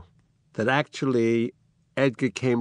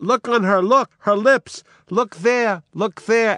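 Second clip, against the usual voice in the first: high, loud, urgent cry; a desperate rush of false joy, much higher; 9 semitones higher, 4 dB louder than usual. Acted. Lear seeing her lips move, high urgent cry. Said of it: "cries out".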